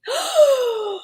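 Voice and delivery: high shocked gasp; sharp gasp. Acted. Reacting to Wendy's last night, gasp. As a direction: gasp at the news.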